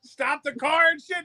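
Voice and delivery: ordinary talk, nothing marked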